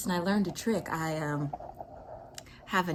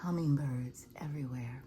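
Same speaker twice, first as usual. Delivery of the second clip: breathy hushed repeat; soft secret whisper; 3 semitones lower, 6 dB quieter than usual. Shown as a demonstration. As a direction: whisper it so you don't scare the birds.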